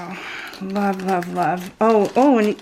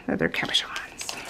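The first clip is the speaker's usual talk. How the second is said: whisper; 3 semitones lower, 9 dB quieter than usual. Real, not acted. Pleased about the charms.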